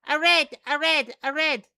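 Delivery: high, loud chirpy critter voice; Magboil voice. Acted. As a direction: chirp like a tiny creature. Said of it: "I love your little Magboil voice".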